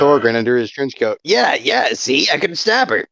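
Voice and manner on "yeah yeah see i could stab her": higher-pitched, lightly voiced eager show-off; eager boasting character. Small sidekick showing Thorgrin the dagger, eager and higher. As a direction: boast it in a raised voice.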